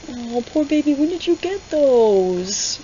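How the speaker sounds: high, slow cooing sympathy; mock tender. He coos at the scarred heroine, high and slow. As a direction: coo it like a pitying sweetheart. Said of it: "He didn't say it like that".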